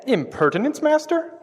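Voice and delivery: cheeky question, leaping high on the last word; mock-servile query that shoots up at the end; impertinent. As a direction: feign innocence with an impertinent upward lilt. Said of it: "Exactly, that. Less, less of that".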